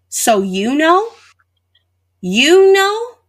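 put-on a disbelieving girl, stunned by the gift; stunned disbelief. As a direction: hit the second "You know?" with shocked disbelief. Shocked at the clock's sender.

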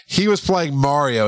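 ordinary talk, nothing marked

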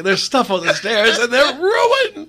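high, slow, loud mock wail; dramatic outrage. Dad replays son's complaint about stairs, dramatic mock outrage. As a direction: imitate an overwrought kid, stretching every complaint. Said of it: "He's so dramatic".

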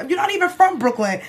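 high-pitched imitated challenge; mock accusation. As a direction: lightly act out a scornful accuser. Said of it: "he's like".

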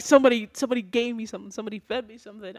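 rapid, mock-panicked quote; imitated freak-out. Co-host imitates Simone freaking out over being dosed, fast mock panic. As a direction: rattle it out like a hammed-up panic. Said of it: "her freaking out".

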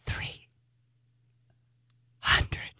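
breathy whispered price. Retold or imitated. Stylist leaning over to whisper the price. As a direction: lean in and whisper it. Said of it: "He leaned over and he goes".

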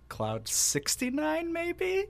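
high, soft, slow suggestive tease; mock sultry. Co-host teasing the audience, high soft sultry mock come-on. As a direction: breathe it out like a come-on. Suggestive joke after cloud nine.

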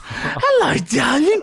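very high falsetto greeting; mock sweet call. Owner hiding rage from onlookers, falsetto sweet call. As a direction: coo it in a put-on high voice.

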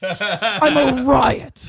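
Gomer Pyle. Pretend. Playing D'Onofrio as Gomer in scene.

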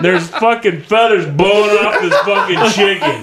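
ordinary delivery

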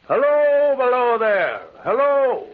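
high, loud hail down the slope; calling again. Acted. [name] calls the man again from the embankment, loud hail. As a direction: shout down so he finally looks up. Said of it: "I called again".

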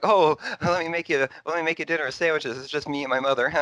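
high, lightly put-on timid young man; mock creepy mama's boy. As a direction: play him sweet and a little odd, up high. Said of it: "If anyone ever said, like".

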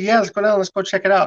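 a hint of the excited buddy urging you on; eager friend. Friend urging him to go see, lightly eager. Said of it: "He said".

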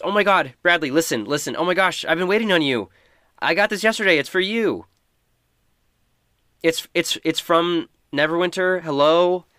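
high, loud and eager, bursting with news; breathless excitement. Acted. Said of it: "And she says".

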